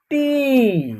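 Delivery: टी with a long, held ee; letter pronunciation. Shown as a demonstration. Teacher showing how T is said, टी held long. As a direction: draw out the ee in टी. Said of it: "इसका उच्चारण होगा".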